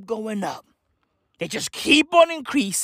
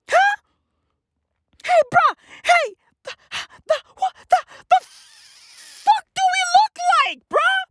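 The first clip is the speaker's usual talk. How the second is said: squeak. Acted. High squeaky outraged question.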